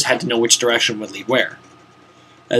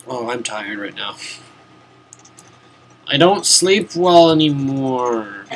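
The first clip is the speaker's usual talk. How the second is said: ordinary talk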